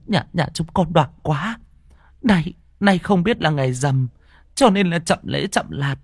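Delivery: a grandmother apologising to the tree spirit, pleading; old woman pleading prayer. Acted. Old woman praying at shrine. (giọng bà lão khấn vái van xin)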